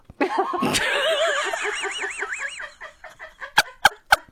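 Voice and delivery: laughing way up high; helpless high laugh; long high laugh. Real, not acted. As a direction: crack up laughing. Hilarious story.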